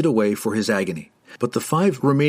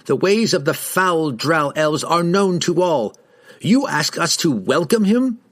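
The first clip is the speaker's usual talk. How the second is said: scornful indignation; 6 semitones higher than usual. A narrator's reading. Rider refuses to welcome a dark elf, indignant. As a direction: declaim your distrust of the drow.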